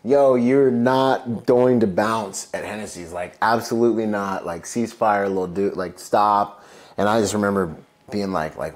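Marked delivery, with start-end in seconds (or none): 0.0-6.6 put-on higher, louder scolding girlfriend, half amused; laughing but pissed. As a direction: shut him down, annoyed but amused. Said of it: "like, laughing, but also pissed".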